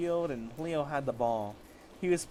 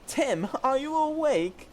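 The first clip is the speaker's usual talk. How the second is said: much higher, slow and loud; high voiced Leo; 11 semitones higher, 7 dB louder, slower than usual. Leo asking hurt Tim if he is awake, high and loud. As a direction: pitch up high for the Pokemon Leo.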